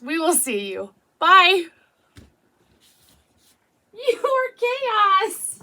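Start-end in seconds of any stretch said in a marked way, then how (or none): 1.2-1.7 big loud higher bye. Real, not acted. Signing off to viewers with a loud bye. 3.9-5.6 much higher, very slow and drawn out; teasing dog talk. Real, not acted. Telling the dog she was chaos, fondly teasing.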